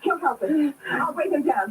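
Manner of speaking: normal talking voice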